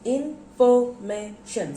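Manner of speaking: INFORMATION chopped into slow, separate syllables. Shown as a demonstration. Teacher counting syllables for pupils, spaced out. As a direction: say INFORMATION one syllable at a time, pausing after each. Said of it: "So there are five syllables".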